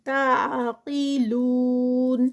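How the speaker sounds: slow drawn-out tajweed recitation, vowels held; Quran recitation. Shown as a demonstration. Tajweed teacher reciting a verse for students, slow melodic recitation. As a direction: chant each word with its lengthening. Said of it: "قاف heavy".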